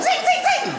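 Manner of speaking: cartoon whoosh of hamsters flung off a turntable; high zinging hamster sound effect. Comedian mimicking hamsters flung off a spinning turntable, high zinging. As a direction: pitch way up and zip out each zing. Hamsters flying off record player.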